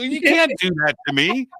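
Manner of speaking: high, teasing protest; ribbing the ex-atheist friend, high and indignant; mock indignation. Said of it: "I go".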